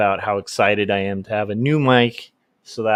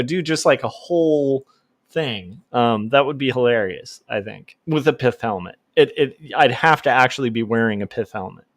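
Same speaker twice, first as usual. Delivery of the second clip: ordinary talk, nothing marked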